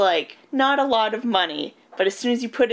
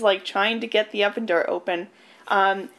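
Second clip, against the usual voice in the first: ordinary talk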